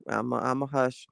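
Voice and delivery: quiet self hush. Real, not acted.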